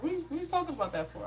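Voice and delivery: high, quick imitation of a questioning viewer; puzzled viewer. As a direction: pitch it up and rattle off the challenge.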